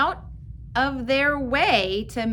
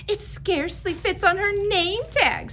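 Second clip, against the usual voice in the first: put-on teasing girl; 9 semitones higher, 3 dB louder than usual. Rita teasing.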